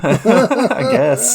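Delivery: awkward thanks breaking into a laugh. Retold or imitated. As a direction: let a high laugh take over the end. Laughs through awkward retold refusal.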